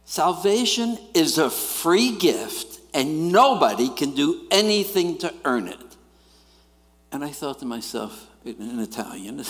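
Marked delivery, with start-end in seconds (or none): 0.0-5.8 preaching pastor. Retold or imitated. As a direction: proclaim it from the pulpit. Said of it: "He said".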